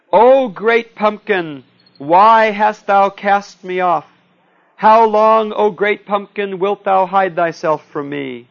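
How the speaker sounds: mock-biblical plea, drawn out; imploring prayer. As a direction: cry out slowly to a silent god. Linus's mock psalm of lament, acted.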